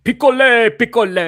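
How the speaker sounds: imitating an ice cream truck announcer; high, blaring vendor's call; loud announcement. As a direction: call it out like a street loudspeaker. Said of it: "ice cream truck that did loud announcements".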